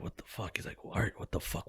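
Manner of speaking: hushed, half-whispered disbelief; imitating scared friend. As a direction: imitate a worried buddy under your breath. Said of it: "he got scared".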